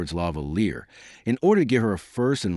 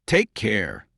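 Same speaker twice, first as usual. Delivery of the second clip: usual speaking voice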